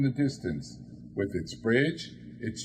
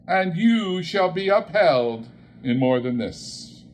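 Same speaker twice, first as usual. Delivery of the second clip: ghost voice; 6 semitones higher, 5 dB louder than usual. Acted. Ghost reassures Scrooge.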